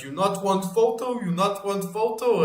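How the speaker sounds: high, insistent broken English; imitated foreign man. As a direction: imitate a pushy foreigner, pitched well up. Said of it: "he's like".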